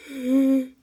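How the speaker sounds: sudden loud gasp; sharp gasp. Real, not acted. Sudden realisation, story pivot.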